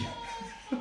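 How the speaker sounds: 短促的气声笑; 自嘲地轻笑一下; short breathy laugh. Real, not acted. Laughs at own anger. (自嘲的气声笑)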